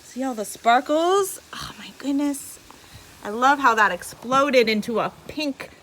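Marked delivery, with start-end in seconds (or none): 0.0-1.4 excited. Real, not acted. Showing sparkles. 1.5-2.6 hushed, amazed whisper; whispered awe. Real, not acted.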